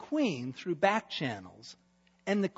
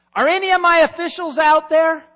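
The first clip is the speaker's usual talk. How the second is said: loud, high call to the antechamber; yelling out; 12 semitones higher, 17 dB louder than usual. Acted. King yelling out for his officials, loud and high. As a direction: shout for the officials. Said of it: "I hear Xerxes yell out".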